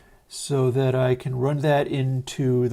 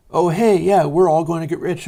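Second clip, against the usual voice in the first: higher, louder, gushing; gleeful parody of boomer optimism; mock excitement; 5 semitones higher, 6 dB louder than usual. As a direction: ham up the naive cheer of a get-rich believer. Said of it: "where they're all like".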